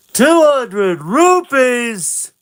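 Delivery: high, loud, slow shout; loud slow call. Acted. Vendors cry the price, high slow shout. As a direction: boom the price out. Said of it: "Then they say".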